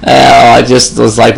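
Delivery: usual speaking voice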